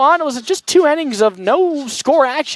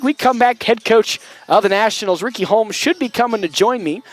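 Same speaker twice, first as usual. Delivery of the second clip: ordinary delivery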